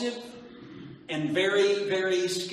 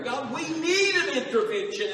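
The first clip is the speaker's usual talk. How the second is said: higher prayer; 7 semitones higher than usual. Retold or imitated. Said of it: "How many prayed that prayer?".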